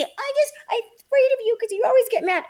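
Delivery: high, fast whiny mimicry of a scared worker; mocking a scared worker in a high fast voice; mock timid employee. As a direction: squeak it out like a cowering employee.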